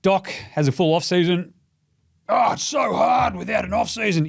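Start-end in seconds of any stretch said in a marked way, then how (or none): 2.3-4.3 high, shouted mockery of a whining coach; mock whiny coach. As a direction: sneer it out like a coach making excuses. Mocking the coach's complaint.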